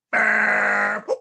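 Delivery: big booming burp sound; loud comic burp. Acted. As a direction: belt out a huge cartoon burp. Mouse burps loudly in story.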